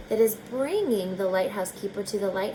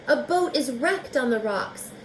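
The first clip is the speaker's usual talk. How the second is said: usual speaking voice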